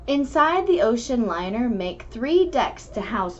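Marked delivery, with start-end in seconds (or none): none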